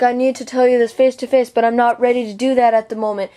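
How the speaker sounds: lightly voiced mock of the girl's plea; a hint of a sappy, put-on voice; mocking imitation. Mocking the girl's email to her, lightly put-on voice. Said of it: "and she's like".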